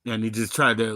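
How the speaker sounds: ordinary delivery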